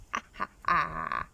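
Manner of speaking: a lightly voiced toothy ha ha ha; storybook croc's gloating laugh, lightly done; mock crocodile laugh. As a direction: play the crocodile chuckling at the little animals. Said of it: "laughing as the small animals".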